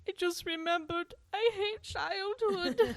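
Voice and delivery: high, slow whiny wail; crying baby voice. Retold or imitated. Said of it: "And he cries".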